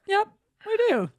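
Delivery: mock bright yes. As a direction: chirp it like you know. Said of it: "I was like".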